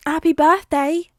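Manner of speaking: put-on high, slow, loud child greeting; a small boy wishing his friend well; shy little boy. A narrator's reading. New friend greets the birthday boy, child voice.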